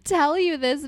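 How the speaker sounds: real laughing fit while confessing something; sheepish, high-pitched and breaking into laughs; embarrassed laughter. Real, not acted. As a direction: talk through high, embarrassed giggles. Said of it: "almost kind of embarrassed".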